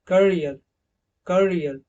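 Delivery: careful pronunciation of करियर; करियर with a clear ईया glide in the middle; model word. Shown as a demonstration. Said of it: "ईया का जो sound".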